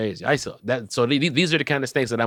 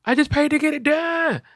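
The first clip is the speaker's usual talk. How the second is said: mock angry mom. Mom angry about ruined hair.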